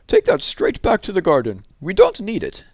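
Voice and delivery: wife telling Mister Brown off about the conker case; stern wife. Acted. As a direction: order him to take it back. Missus Brown scolding.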